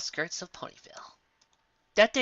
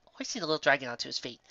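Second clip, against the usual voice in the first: ordinary delivery, nothing marked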